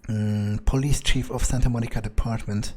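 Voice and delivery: normal talking voice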